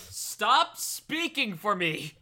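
high, slow, drawn-out protest; mock indignant. Player objecting to others answering for them, mock indignant.

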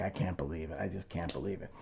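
low, hushed retold muttering; soft, dropped-down voice of disbelief; stunned disbelief. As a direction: imitate a dumbfounded bandmate under his breath. Drummer stunned, retold after disaster.

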